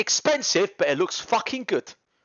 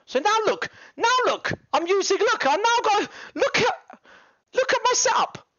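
high excited look look look; excited showing off; 12 semitones higher than usual. Real, not acted. Showing viewers his new setup, excited and high. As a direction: hype them up about the setup.

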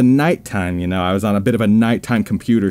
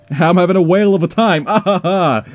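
mock cheer; 6 semitones higher than usual. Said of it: "by that I mean I am not".

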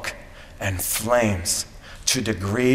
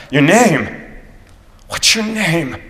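loud, high urgent call; yelling; 8 semitones higher, 7 dB louder, slower than usual. A narrator's reading. Shawnee chief calling after the departing captain, yelling. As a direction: yell after someone walking away. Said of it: "the Shawnee chief yells one more time".

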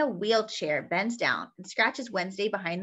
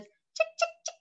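very high chirping; high imaginary bird chirps; bird call. Pretend. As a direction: peep it like a small bird. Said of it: "And this one says".

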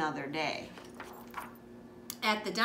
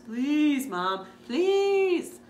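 high, loud pleading; begging; 5 semitones higher, 7 dB louder, much slower than usual. Acted. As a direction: plead with everything you've got. Child begging mom for dinosaurs.

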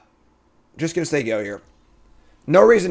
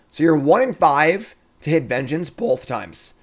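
usual speaking voice

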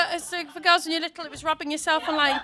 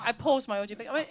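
ordinary delivery

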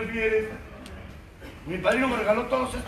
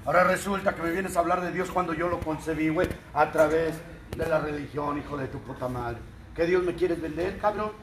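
lightly played rude Irishman, pitched lower; rough Irishman; 6 semitones lower, 4 dB quieter than usual. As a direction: lower voice, a hint of a rude Irish tough guy. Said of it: "te voy a hablar como el rudo irlandés".

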